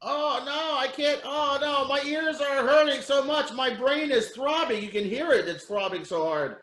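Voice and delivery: high, whining bird in mock agony; a pet bird hamming up its suffering; whiny bird voice.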